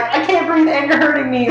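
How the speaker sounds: high strained plea, imitating panic; panicked. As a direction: gasp it out like being choked. Retelling being choked.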